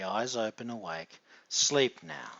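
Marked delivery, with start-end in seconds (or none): 1.5-2.3 loud, sharp trigger command; firm command. Acted. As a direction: bark the trigger words loud.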